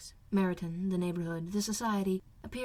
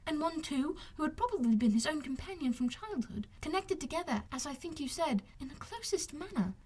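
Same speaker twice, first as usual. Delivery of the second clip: put-on higher, softer musing young woman; young lady, softer; 7 semitones higher, 3 dB quieter than usual. Elizabeth musing to Wickham, higher and softer. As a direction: draw it out gently.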